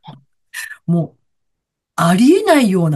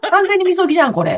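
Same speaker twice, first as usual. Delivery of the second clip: lightly acted gleeful exclamation, higher pitched; retold delight; 8 semitones higher, faster than usual. Traveller greeting the downpour as purification, mock-delighted exclamation. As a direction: re-enact a pleased realization. Retelling her delighted cry in rain.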